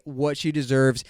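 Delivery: ordinary talk, nothing marked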